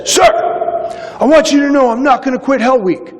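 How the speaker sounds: high, fast, eager declaration; eager recruit. Retold or imitated. Recruit promising his officer he won't quit, high and eager. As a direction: sound like a keen young sailor swearing he'll stick it out.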